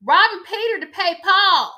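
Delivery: loud, high-pitched exaggerated imitation; hammed-up, high and loud quote; Sutton impression. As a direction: belt it out as an overblown mimicry. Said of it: "in words of Satan".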